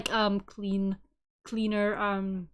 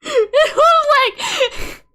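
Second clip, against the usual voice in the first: laughing through words; 9 dB louder, much slower than usual. Real, not acted. Laughing while retelling.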